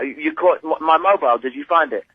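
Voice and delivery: usual speaking voice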